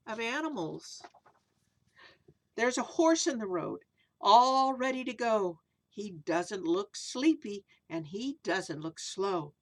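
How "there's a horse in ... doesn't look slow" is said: sing-song rhyming read-aloud, slow in places; storybook verse reading; reading to children. A narrator's reading. Reads rhyming picture book aloud.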